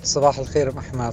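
ordinary talk

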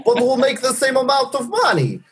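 put-on Russian accent. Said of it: "I can make a Russian accent".

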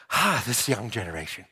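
lightly voiced tired grandma; grumbling grandma. Grandma complaining to him about the kids, a hint of a weary sigh. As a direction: sigh it like a weary old lady. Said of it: "and she says".

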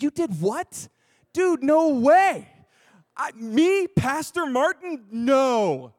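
high, loud, stretched-out disbelief; incredulous outburst. Retold or imitated. Man refusing friend's youth pastor idea, loud incredulous protest. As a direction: balk loudly, pitch leaping way up.

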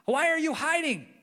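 high, loud mock scolding; angry God. God as misread, storming at Adam and Eve in loud mock anger. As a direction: play the furious God people imagine. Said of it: "We kinda read it sometimes like".

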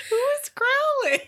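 high question squeezed through laughter; real laughing. Real, not acted. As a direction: barely get it out for laughing. Repeats the question while cracking up.

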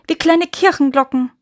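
high, loud admiration; enraptured gushing. A narrator's reading. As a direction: swoon aloud like a delighted chaplain. Chaplain enraptured by croaking frogs. (verzückt, hoch und laut)

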